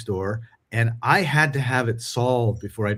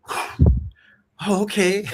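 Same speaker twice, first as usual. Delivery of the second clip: surprised customer; 9 semitones higher, much slower than usual. Retold or imitated. Customer disarmed.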